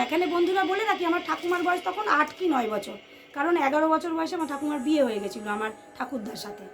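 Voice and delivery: usual speaking voice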